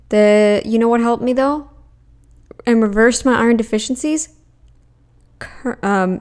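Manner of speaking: ordinary talk, nothing marked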